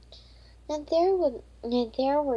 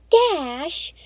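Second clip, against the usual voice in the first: higher and louder embarrassed cry of a name; embarrassed exclamation; 6 semitones higher, 8 dB louder than usual. Acted. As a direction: blurt the name out to stop her friend. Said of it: "Raina exclaimed".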